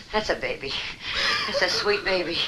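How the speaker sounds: soft, hushed cooing; soothing. Acted. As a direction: rock her calm with your voice. Man soothes crying woman.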